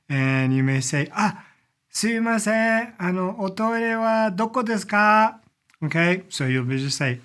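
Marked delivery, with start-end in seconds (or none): 1.1-5.3 higher, slowed, polite Japanese question; politely flag someone down in Japanese; getting someone's attention. Acted. Asking someone where the restroom is, higher attention-getting voice. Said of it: "used when you're trying to get somebody's attention".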